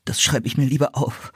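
put-on boy's voice, shivering with cold; trembling from the freezing air. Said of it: "sagte Philipp zitternd".

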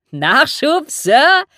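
cheeky, high, loud query called down; loud, impudent questioning. A narrator's reading. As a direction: shout it back with an impudent grin. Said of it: "fragte Pinsley und grinste frech".

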